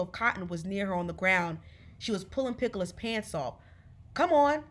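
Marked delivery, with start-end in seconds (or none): 4.1-4.6 higher, louder, pushy urging; urging call. A narrator's reading. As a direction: hurry her along out loud. Frida urging Piccola to step out.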